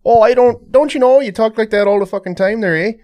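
loud, high mock Wisconsin accent. Teasing a friend about Wisconsinites, loud mock Wisconsin accent. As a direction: ham up a Wisconsin twang. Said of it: "well, that's Irish".

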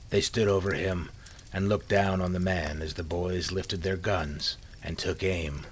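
usual speaking voice

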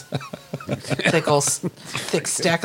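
slow and savoring. Acted. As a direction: drag the words out. Savoring the sandwich.

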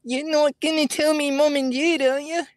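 very high, squeaky put-on child voice; pleading child. Child asking not to be told on, in a high voice. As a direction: set up the bit as a high-pitched kid. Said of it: "I got a new impression".

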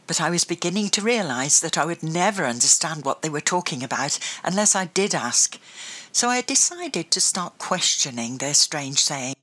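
usual speaking voice

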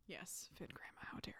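hushed whisper, barely voiced. Real, not acted. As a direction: whisper it like a guilty aside. Mock self-scolding joke.

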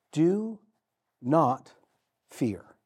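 each word set apart slowly; Do, not, fear, one by one; slow, spaced proclamation. Acted. Angel reassures Mary, each word slow and apart.

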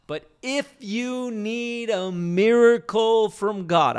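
loud, high, slow preacher's proclamation; preaching it big. Retold or imitated. Preacher quoting Reinhard Bonnke, loud and high. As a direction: boom out the miracle line. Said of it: "I wish I could say it in the German brogue that he had".

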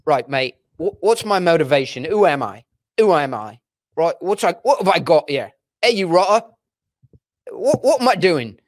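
mock British accent, actor fretting over his role; imitated Oxford-accented actor seeking direction. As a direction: put on an exaggerated English actor's accent. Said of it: "he's got this perfect Oxford".

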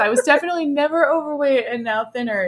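laughing high mock insistence; sarcastic. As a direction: deny it through laughter.

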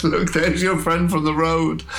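high-pitched, amused taunting voice; laughing William. Acted. William shows the runner the figure, amused. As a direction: point it out in high, gleeful tones. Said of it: "laughs and he says".

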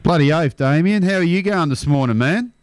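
ordinary delivery

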